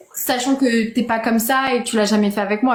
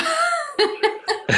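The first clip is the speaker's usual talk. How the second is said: high giggle; 4 dB quieter than usual. Real, not acted. Amused by her own remark.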